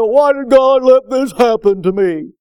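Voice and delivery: mock complaining believer; whiny complaint. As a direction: whine it like a believer blaming God. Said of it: "immediately start saying".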